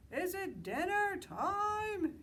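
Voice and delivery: a very high, loud, slow bellow. Acted. Sheep bellowing to Mister Moose, very high and loud. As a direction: Sheep bellows it out, dragging the words. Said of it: "he bellowed".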